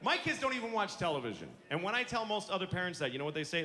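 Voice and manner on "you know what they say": normal talking voice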